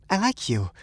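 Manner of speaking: high woman's voice, ordinary pace; high, earnest little admission; shy confession. A narrator's reading. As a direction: confess it high without dragging it out.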